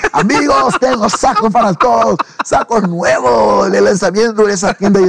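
high cheerful call to friends down the hall. Retold or imitated. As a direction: sing it out like handing out gifts. Calling out to bandmates at doors. (llamando a los amigos, voz alta y alegre)

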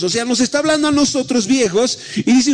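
lively preacher to congregation; preaching. Acted. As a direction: preach with rising fervour. Preacher sermon delivery.

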